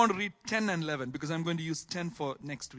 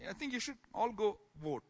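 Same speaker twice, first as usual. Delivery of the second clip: ordinary talk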